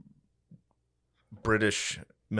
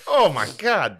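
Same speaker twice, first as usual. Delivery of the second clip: mock shock; 8 semitones higher, 5 dB louder than usual. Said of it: "I was just like".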